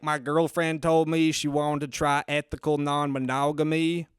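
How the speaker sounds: deadpan Southern comic character; Chuckleberry Finn. Acted. Comic performing his joke for the host, Southern accent. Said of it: "try saying them in a Southern accent".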